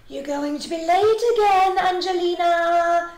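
high, loud mother's call; crying out. A narrator's reading. Mother warning her dawdling daughter about school, crying out. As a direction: stretch the name out in a rising-pitched holler. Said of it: "cried Missus Mousling".

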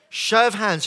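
loud rallying call. Real, not acted. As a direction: wake the crowd up. Calls again to audience.

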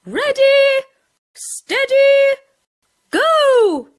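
high, loud teacher's shout; starting a race, loud. A narrator's reading. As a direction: call the race start across the field. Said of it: "The teacher shouted loudly".

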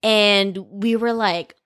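ordinary delivery

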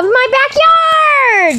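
excited shout. Real, not acted. Opening the video with excitement.

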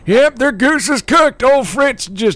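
put-on high, fast, loud old-timer bragging; old grandpa voice. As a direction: crank up the wacky grandpa. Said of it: "imitating my grandfather".

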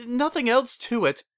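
high-pitched, flustered insistence; shrill squeak. A narrator's reading. Flustered daughter dismissing the makeup question, high squeak. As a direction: keep squeaking, well above normal pitch. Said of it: "she squeaked in a shriller than usual voice".

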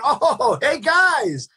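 high, drawn-out fake welcome; mock-pleased greeting. Pizza man to returning cops, high mock-pleased hello. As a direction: greet unwanted guests with put-on delight.

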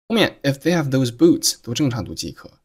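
usual speaking voice

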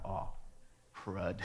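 low, soft muttered "crud"; deflated dread. Retold or imitated. Boy sees the punched friend pop back up, deflated. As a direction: let it drop, quiet and sunk.

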